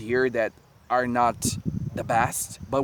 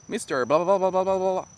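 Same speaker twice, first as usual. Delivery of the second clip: rattling off blah blah, a bit higher; mock fast gabble; 7 semitones higher, faster than usual. The airport lady at the tourist, mock fast gabble. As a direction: imitate a local rushing her words. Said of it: "very fast".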